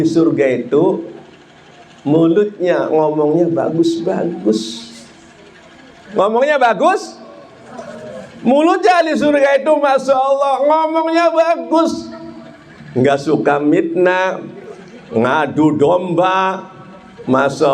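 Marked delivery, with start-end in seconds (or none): 6.1-12.1 high-pitched, sustained preacher's delivery; impassioned preaching. Acted. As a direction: preach it high to the whole congregation. (ceramah berapi-api dengan nada tinggi)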